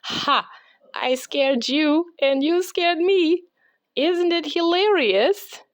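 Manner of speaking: put-on high, amused girl voice; laughing Lucy. A narrator's reading. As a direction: tease a friend with a giggle. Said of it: "Lucy laughed and said".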